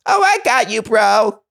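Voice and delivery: very high cartoon voice, tossed off; squeaky plant voice. Plants lost in the desert, in a squeaky high voice. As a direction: squeak it out like a tiny plant creature.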